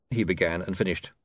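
usual speaking voice

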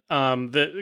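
normal talking voice

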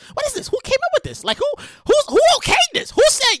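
high, rising disbelief; incredulous. Real, not acted. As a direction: demand who allowed it. Outraged questioning of the monument.

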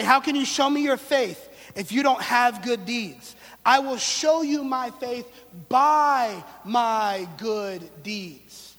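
loud, slowing to a drawn-out finish; forceful challenge. Acted. Preacher voicing James to the congregation, loud and slowing. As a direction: stretch the last words out for weight.

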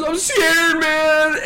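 high, drawn-out mock wail; scared wail. Friend held onto in speeding truck, mock scared wail. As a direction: imitate a friend wailing in fright. Same frightened friend quoted again.

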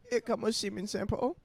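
high broken-English mock accent; put-on accent, lifted and rising; mock foreign accent. Friend riffing on the meme, mock foreign accent.

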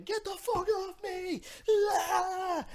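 high, drawn-out strangled scream; mock screaming. Boy being dragged back to camp by counselors, imitated screaming. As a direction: scream it high and stretched, without full volume. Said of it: "I'm screaming".

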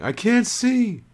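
high, loud panicked cries; blinded woman calling for Eslander in terror; shouted in despair. A narrator's reading. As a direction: scream for him blindly, terrified. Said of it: "she shouted in despair".

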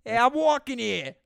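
high, loud Jersey shout; Jersey accent. Acted. Cohost playing a Jersey pedestrian, loud Jersey shout. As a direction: holler like an indignant Jersey pedestrian. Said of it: "we're in downtown Jersey".

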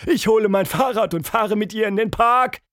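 high, loud holler; calling back. A narrator's reading. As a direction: shout it up to the window. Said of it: "Miss Anita rief zurück". (laut zurückgerufen)

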